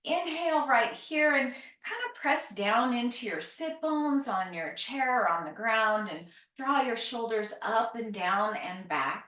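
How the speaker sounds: ordinary talk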